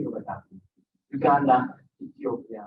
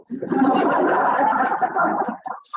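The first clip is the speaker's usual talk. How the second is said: a breathy high laugh; airy laugh; 11 semitones higher than usual. Real, not acted. Father laughing about his daughter taking his powers, an airy laugh. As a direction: laugh out at the punchline.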